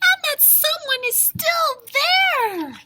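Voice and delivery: very high, loud cub; excited Baby Bear. A narrator's reading. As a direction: squeal it like a tiny bear. Baby Bear finds Goldilocks.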